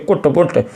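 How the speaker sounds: "कुट, पुट" both with a short oo; wrong vowel. Shown as a demonstration. Teacher listing misreadings for learners, mispronounced. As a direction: give each u in "कुट, पुट" an oo sound. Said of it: "ऐसे नहीं पढ़ना है".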